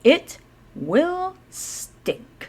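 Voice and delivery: slow and drawn out. A narrator's reading. Drawn out stink warning.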